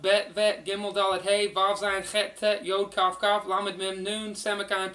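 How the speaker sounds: ordinary talk, nothing marked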